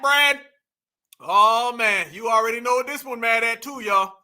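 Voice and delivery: ordinary talk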